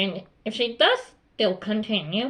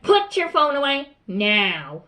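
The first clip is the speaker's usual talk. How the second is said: slow, drawn-out command, pitched higher; stern teacher's order; 4 semitones higher, 4 dB louder, slower than usual. Acted. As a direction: order it slowly, landing firmly on the last word. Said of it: "then he will be like".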